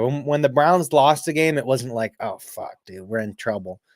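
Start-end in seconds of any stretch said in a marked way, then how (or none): 2.2-3.8 hushed, much softer mock dread; lightly voiced fretting fan, much softer; mock worried fan. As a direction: drop to a near-whisper of fake worry. Mock panicked fan quote.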